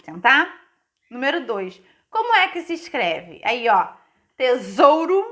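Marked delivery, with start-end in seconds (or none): 4.4-5.2 "Tesouro" loud and higher, with a buzzed Z for the S; S sounded as Z. Shown as a demonstration. Teacher models reading for pupils, loud Z sound in "Tesouro". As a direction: sound out "Tesouro", voiced Z in the middle. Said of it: "utilizando as mesmas regrinhas".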